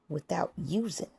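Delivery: ordinary talk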